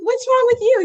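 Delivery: high, loud startled question; startled cry. Retold or imitated. To her crying husband, a high loud shocked question. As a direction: burst out at him, shocked. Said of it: "I'm like".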